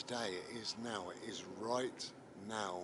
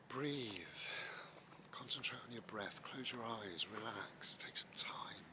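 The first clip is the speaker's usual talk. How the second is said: guided meditation; 5 dB quieter than usual. Acted. Leads a breathing meditation.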